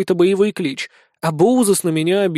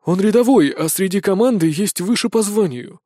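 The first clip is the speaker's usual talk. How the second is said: usual speaking voice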